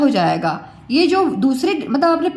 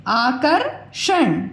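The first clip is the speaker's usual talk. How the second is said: slow demo reading; much slower than usual. Shown as a demonstration. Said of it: "repeated slow demo word".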